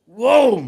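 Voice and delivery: loud, high "whoa" burst; explosive mock-startled whoa; retold whoa. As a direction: blurt a big startled whoa mid-story. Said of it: "and I go".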